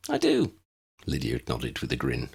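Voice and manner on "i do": put-on girl's voice. A narrator's reading. Lydia voiced by the reader.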